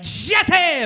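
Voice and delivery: loud high showbiz cry of the name; sing-song loud Jesse; flamboyant shout. Pretend. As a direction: belt the name out with jazz hands flair. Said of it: "I did it like".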